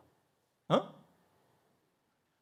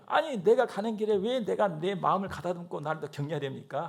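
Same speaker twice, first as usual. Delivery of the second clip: ordinary talk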